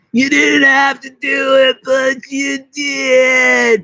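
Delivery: high, loud, very slow shout; high drawn-out yelled catchphrase; shouted impression. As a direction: belt the catchphrase. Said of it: "I'm just doing impressions".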